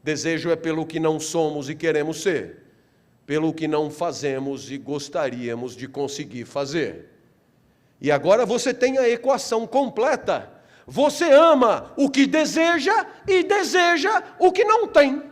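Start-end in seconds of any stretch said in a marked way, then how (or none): none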